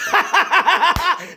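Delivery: high cackling villain laugh; gloating laugh. Acted. Taunting Americans, a high gloating cackle. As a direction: cackle over the enemy.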